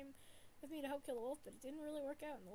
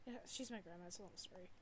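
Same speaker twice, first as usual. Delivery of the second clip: normal talking voice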